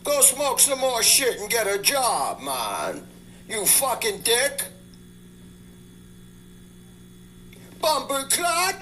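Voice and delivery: high, slow Jamaican cursing; Jamaican accent. Acted. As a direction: yell Jamaican insults, stretched out. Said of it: "you gotta excuse my Jamaican accent".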